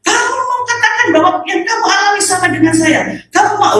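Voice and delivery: high indignant challenge; imitating the sister's angry retort. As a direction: confront her, voice high. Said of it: "tambah marah".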